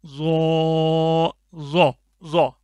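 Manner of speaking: ज़ो said thick and firm, held long; slow heavy ज़ो. Shown as a demonstration. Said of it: "मोटा करके भी पढ़ना है और थोड़ा सख्ती के साथ".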